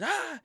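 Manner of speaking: imitated outburst. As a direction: mimic a sudden high outburst. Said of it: "the dude walks by and, and goes".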